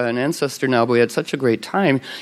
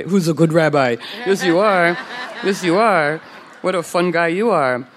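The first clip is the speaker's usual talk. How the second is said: put-on baby talk to a pet; 4 semitones higher than usual. Talking to rabbi like a pet.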